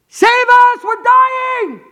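very high, full-force scream; desperate shout of drowning men; terrified cry for rescue. Acted. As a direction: scream as if the boat is sinking. Acts disciples crying out in terror.

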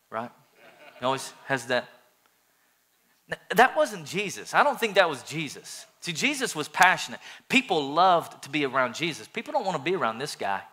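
ordinary talk, nothing marked

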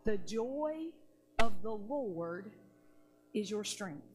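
slow, low, with long pauses between phrases; weighty proclamation. Retold or imitated. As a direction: set each phrase down slowly and deeply. Key verse repeated for weight.

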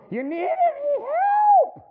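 very high, loud and slow offer of help; eager helper piping up; slow, squeaky, loud offer; Brother Glenn's high voice. Retold or imitated. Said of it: "He had that high-pitched voice".

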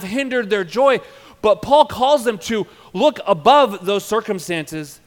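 loud, high, urgent sermon delivery; impassioned pulpit preaching; full-force preaching. Acted. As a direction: drive the point home to the congregation.

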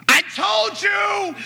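high, drawn-out sarcastic shriek of I told you; a sugary-sweet yell that is really an accusation; mock loving scream. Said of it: "very lovingly".

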